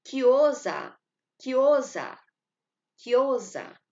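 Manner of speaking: quiosa said loud as one fused block, repeated; joined as one block. Shown as a demonstration. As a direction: run quiosa together with no break. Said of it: "Parece que a gente tá falando uma coisa só".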